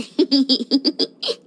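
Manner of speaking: low, mischievous run of giggles; scheming giggle. Acted. Inventor expecting spare parts to collect, scheming giggle. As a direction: giggle slyly to yourself.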